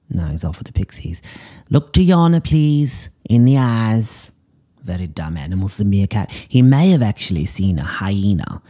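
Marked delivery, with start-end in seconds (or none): 1.7-4.3 high, loud psychic command; calling out. Acted. Psychic commanding the meerkat to look at her, loud call. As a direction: call to the meerkat, much higher and louder.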